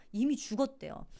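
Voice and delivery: ordinary delivery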